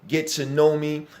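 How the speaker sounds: ordinary talk, nothing marked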